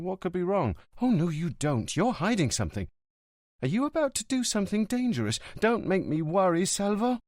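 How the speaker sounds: put-on high, anxious girlfriend pressing him; suddenly agitated. A narrator's reading. Livia suspects Montalbano is in danger, agitated. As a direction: wake up and press him, worried. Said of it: "becoming more awake and agitated".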